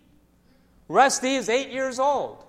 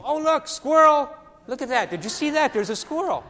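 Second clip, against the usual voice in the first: mock distraction; 6 semitones higher, 4 dB louder than usual. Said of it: "and you go".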